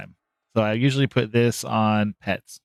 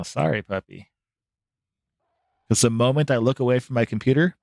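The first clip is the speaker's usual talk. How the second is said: usual speaking voice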